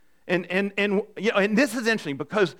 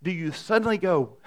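ordinary delivery

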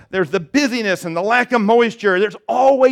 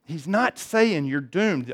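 ordinary talk, nothing marked

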